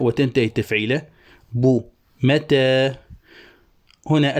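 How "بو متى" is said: بو متى drawn out very slowly; slow metrical chant of بو متى; scanning syllables. Shown as a demonstration. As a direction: stretch each syllable of بو متى. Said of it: "بو الباء متحركة".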